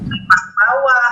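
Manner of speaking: ordinary talk, nothing marked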